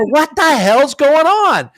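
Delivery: slow, loud, high exasperated cry; exasperated outburst. Retold or imitated. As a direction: drag it out in loud disbelief. Retold radio call, exasperated.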